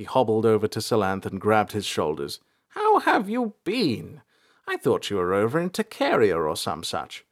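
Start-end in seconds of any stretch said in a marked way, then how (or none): 2.7-7.2 put-on old elder, welcoming. A narrator's reading. Elder grabs Salanth's shoulders in greeting, welcoming. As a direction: greet a long-lost protégé in an old man's voice.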